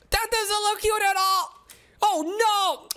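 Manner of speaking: a shocked take-back; alarmed outburst. Real, not acted. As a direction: recoil from the ugly kitty. Scary kitty appears.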